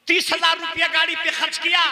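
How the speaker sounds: emphatic pulpit outcry; indignant repeat. Real, not acted. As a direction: throw the sum out at the crowd. Repeats expense with more force.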